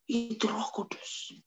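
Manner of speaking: soft, slow unvoiced whisper; whispered reply. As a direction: hush it reverently. Jesus answering, whispered. (jawaban berbisik pelan)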